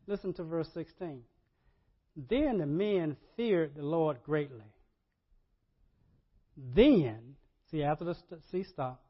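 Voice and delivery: ordinary delivery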